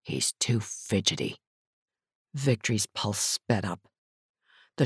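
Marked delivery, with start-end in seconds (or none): 0.0-1.4 low, soft aside; quiet warning. A narrator's reading. Partner quietly warns her about the short thug. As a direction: keep it under your breath. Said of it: "Ryan said to her quietly".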